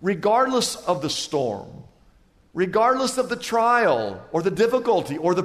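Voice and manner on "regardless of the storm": ordinary talk, nothing marked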